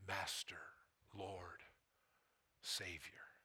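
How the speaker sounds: soft whispered answers, slow; reverent whisper. Acted. Preacher modeling the worshipper's reply to Jesus, a reverent whisper. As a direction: whisper each name in devotion.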